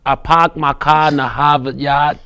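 dropped r's, Boston "pahked my cah in Hahvahd yahd"; a laughing try at a Boston accent; Boston accent attempt. Shown as a demonstration. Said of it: "in your best Boston accent".

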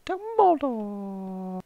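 long drawn-out high call, slow; Dumbledore call. Pretend. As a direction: wail the name like a mock summons. Calling out as a joke.

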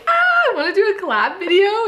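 high, laughing mock enthusiasm; mock eager self. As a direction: play your past self, giddy and naive. Mocking her own past hopeful idea.

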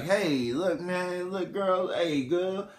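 smooth-talking come-on, climbing high; soft opener sliding into high playful sweet talk. Retold or imitated. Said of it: "or [name]'s like".

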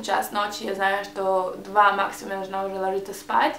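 ordinary talk, nothing marked

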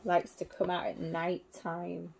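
ordinary delivery, nothing marked

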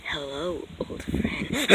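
soft breathy villain greeting; villain whispers to the captive; menacing whisper. Acted. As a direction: breathe it out sinister and hushed.

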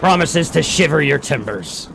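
slow Bristolian pirate drawl; Bristolian pirate accent. Pretend. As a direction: growl it like a West Country pirate. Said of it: "None would sound like a pirate".

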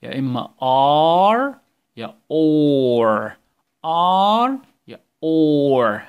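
ar and or drawn out slowly and loud; contrasting ar and or. Shown as a demonstration. Teacher contrasting ar and or sounds for the class. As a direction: hold each vowel long so they hear it. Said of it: "من الأصوات اللي خدناهم".

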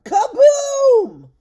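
huge loud high kaboom; booming explosion. Acted. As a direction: blast it out like a bang. Dragon transformation explosion.

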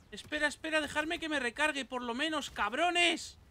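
high, agitated complaint; indignant outcry. Real, not acted. Indignant at attackers in game.

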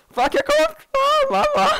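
high, frantic scream of fright; panicked scream. Real, not acted. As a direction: shriek as the mob closes in. Mob chases player in game.

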